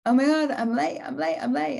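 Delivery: hammed-up rushing alarm; mock panic. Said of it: "I'd be like".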